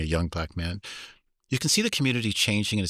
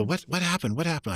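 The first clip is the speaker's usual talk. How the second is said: put-on high, eager children's voices; eager kids; 8 semitones higher than usual. Kids pleading to hear the scary story, eager. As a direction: beg like curious kids.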